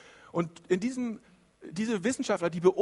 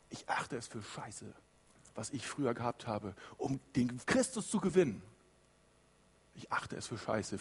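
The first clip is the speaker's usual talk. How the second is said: hushed confession; 6 semitones lower, 8 dB quieter than usual. Acted. Paul's blunt confession, voiced low.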